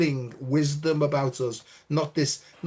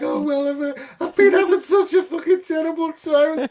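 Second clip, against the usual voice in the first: high, louder outpouring of a troubled person; gushing confession; 12 semitones higher, 5 dB louder than usual. Retold or imitated. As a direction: let it all come spilling out. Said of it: "suddenly it's like".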